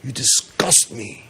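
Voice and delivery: a quiet, hammed-up parent pushing the child away; mock disgusted parent. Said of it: "and saying".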